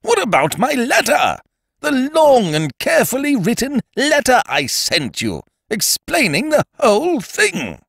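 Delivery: high, loud, aggrieved protest; high indignant outburst; indignant Ukridge. Acted. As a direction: demand an answer in a high, wounded voice. Ukridge indignant about letter.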